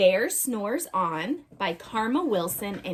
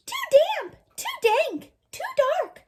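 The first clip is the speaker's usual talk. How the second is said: very high, tiny squeak of a mouse; a small mouse squeaking its complaint; little mouse voice, way up high; squeaky mouse. A narrator's reading. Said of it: "Mouse squeaks".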